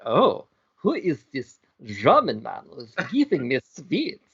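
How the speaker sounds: swooping, hammed-up French accent. Single lady wondering about her admirer, French-tinged accent. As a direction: try German, drift into swooping French. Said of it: "Going French again".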